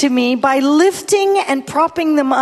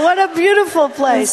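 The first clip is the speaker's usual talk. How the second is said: ordinary delivery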